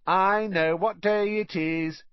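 high, slow taunting chant; jeering sing-song. A narrator's reading. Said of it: "Dudley repeated".

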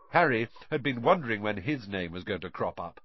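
ordinary delivery, nothing marked

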